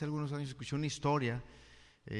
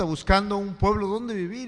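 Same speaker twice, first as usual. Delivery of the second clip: usual speaking voice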